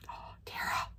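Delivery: breathless re-enacted whisper, very soft; stunned whisper. Telling friend about the found ball, stunned whisper. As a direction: whisper it in shock.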